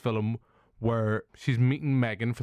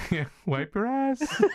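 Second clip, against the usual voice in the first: higher, slow, laughing through it; laughing mock nanny; 10 semitones higher, slower than usual. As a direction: giggle it out in the robot voice. Mocking the nanny robot.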